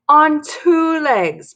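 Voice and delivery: high, loud, drawn-out yell; exasperated mom's slow, loud order. Acted. Mom yells at Dory pretending to be a dog, yelling. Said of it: "my mom yells".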